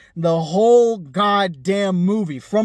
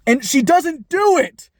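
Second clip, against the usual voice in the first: ordinary delivery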